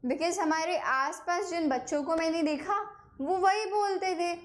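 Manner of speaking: ordinary talk, nothing marked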